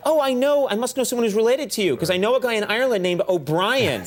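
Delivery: an American sure he knows an O'Brien; mock eager American. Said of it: "they'll say, like".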